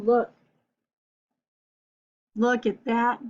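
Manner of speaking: usual speaking voice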